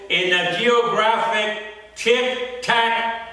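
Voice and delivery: slow, drawn-out incantatory poem reading; poem recitation. Acted. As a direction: recite solemnly, stretching words, pausing between lines.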